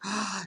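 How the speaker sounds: sharp imitated gasp of realization; shocked gasp. Son looks down and recognizes the man, shocked gasp. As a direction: gasp as if suddenly recognizing danger.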